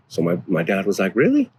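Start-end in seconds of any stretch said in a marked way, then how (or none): 1.2-1.5 high, sharp retold "Really?"; surprised dad. Dad hears his son was disrespected, surprised. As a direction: jump way up in surprise.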